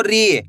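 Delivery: "री" held long, falling at the end; drawn-out long ee. Shown as a demonstration. Teacher gives learners an example, stretched "री". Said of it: "खींच के पढ़ोगे".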